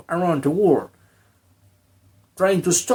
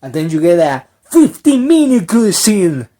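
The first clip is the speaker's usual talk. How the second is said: ordinary delivery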